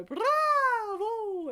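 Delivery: very high, loud, ringing bravo; soaring bravo. Shown as a demonstration. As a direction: call it from the top of the voice. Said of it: "See how high you can go".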